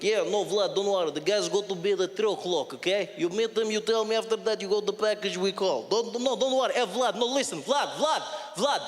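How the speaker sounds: put-on shady Russian criminal on the phone, thick accent; insistent Russian-accented phone call; Russian-accented English. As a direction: give Vlad orders in a heavy Russian accent. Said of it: "the English-Russian accent".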